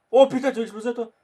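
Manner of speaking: high, loud threat; excited shout. Real, not acted. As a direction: shout it in the heat of the game. Threatens opponent in game action.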